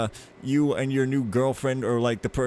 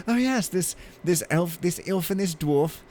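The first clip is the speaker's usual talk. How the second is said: put-on New Zealand accent; 6 semitones higher than usual. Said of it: "how can I do that?".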